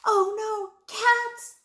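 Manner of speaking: high, loud cry of dismay; alarmed. A narrator's reading. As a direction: exclaim it in sudden alarm. Martha's alarmed thought, acted out.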